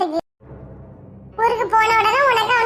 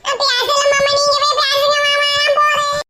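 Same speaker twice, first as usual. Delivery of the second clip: nervous pleading; 3 semitones higher than usual. Acted. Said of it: "எனக்கு பயமா இருக்கு மாமா".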